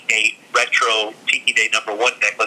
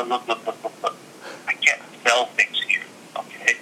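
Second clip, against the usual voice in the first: much softer, hushed pleading; hushed urging; 6 dB quieter than usual. Retold or imitated. Seller hushing the buyer near the castle, much softer. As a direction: hush him before anyone hears. Please, please speak a little lower.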